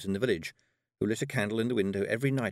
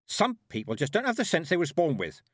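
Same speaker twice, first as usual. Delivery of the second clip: higher, louder brisk order; commanding; 7 semitones higher, 4 dB louder than usual. Acted. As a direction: snap her into moving at once. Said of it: "she commanded".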